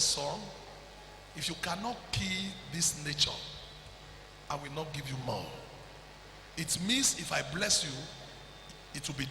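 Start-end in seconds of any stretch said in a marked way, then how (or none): 0.0-5.5 low, softer solemn voice; a hint of God's low voice. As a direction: speak as God, low and grave. Voicing God's words.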